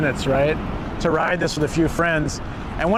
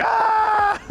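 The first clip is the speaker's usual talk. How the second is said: short, high retold scream on the drop; high mock scream. Retelling his own scream on the big drop, high mock scream. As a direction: yelp it out high like the coaster just dropped. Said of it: "I was like".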